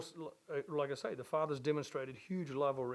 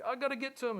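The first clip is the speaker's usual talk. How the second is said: excited father; 9 semitones higher, faster than usual. Retold or imitated. Father spots son far off.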